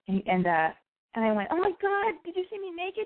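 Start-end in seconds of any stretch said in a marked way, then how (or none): 1.5-3.0 high-pitched re-enacted alarm; hammed-up embarrassed squeal; mortified panic. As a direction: ask in acted horror, voice shooting up. Acts out mortified question to manager.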